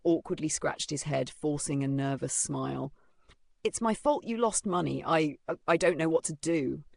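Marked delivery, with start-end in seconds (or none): none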